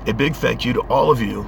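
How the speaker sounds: ordinary delivery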